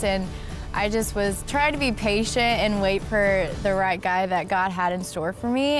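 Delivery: usual speaking voice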